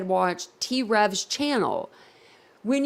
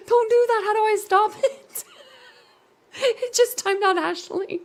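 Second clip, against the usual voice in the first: high-pitched, real helpless laughter through the words; laughing hard; 9 semitones higher, 4 dB louder than usual. Real, not acted. Streamer shocked her chat bot timed out Ashley, laughing hard. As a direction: gasp and laugh while trying to talk. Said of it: "I'm laughing so hard, I'm crying".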